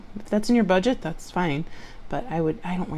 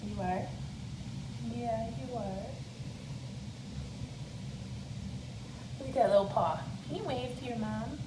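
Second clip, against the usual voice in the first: talking to a pet; 4 semitones higher, 15 dB quieter than usual. Real, not acted. Cooing to dog Goldie.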